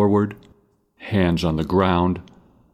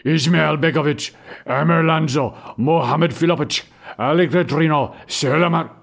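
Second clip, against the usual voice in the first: calling out names; 9 semitones higher than usual. A narrator's reading. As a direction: bark out names like a soldier reading a list. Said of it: "he calls out, reading from a paper".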